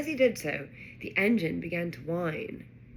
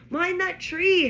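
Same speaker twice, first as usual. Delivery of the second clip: high, loud warning shout; bellow; 12 semitones higher, 7 dB louder, slower than usual. A narrator's reading. Harry warns Ron before the crash, bellowing. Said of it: "Harry bellowed".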